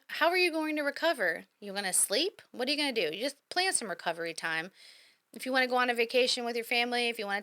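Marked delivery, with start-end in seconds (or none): none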